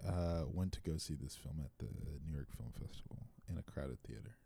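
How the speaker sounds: low voice fading away softly; too quiet. Real, not acted. Guest giving a first reaction to the film, really quiet and fading. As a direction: let it sink down until barely projected. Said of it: "Louder for the people in the back".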